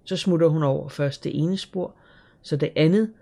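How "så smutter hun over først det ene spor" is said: ordinary delivery, nothing marked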